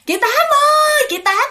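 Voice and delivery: very high, loud call from far off; frightened neighbor calling for help from afar; calling out in panic. Retold or imitated. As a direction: scream for the neighbor across the field. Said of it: "nghe kêu".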